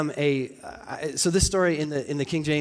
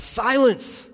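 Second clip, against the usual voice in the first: loud, higher command; sharp raised order; forceful imperative; 7 semitones higher, 6 dB louder than usual. Acted. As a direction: call for silence with exclamation. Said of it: "There's a lot of force behind them".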